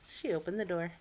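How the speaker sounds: ordinary delivery, nothing marked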